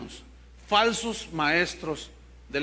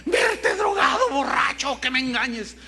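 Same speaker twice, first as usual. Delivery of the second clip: indignant shout; 6 semitones higher than usual. Retold or imitated. A spouse rejecting a partner's conversion, in a high outraged shout. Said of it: "Hay personas que dicen".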